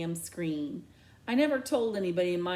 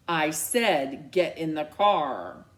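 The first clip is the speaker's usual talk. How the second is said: louder, slow, no-argument command; firm order; 3 semitones lower, 5 dB louder, slower than usual. Acted. Mom firmly ordering her daughter into the car. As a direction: lay down the law.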